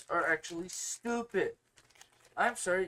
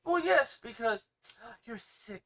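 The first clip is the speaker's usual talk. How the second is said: high, sarcastic overreaction with a gasp; mock alarm; 5 semitones higher, slower than usual. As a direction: imitate a scandalized alarmist. Mocks people who assume covid.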